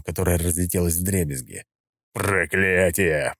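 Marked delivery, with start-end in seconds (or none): 2.1-3.3 slow pained growl. A narrator's reading. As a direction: growl it through the pain. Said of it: "прорычал Скала".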